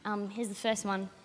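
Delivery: ordinary delivery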